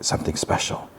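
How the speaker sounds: retold line in a soft whisper; quiet. The other guy quietly telling Joe, whispered. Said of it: "And quietly".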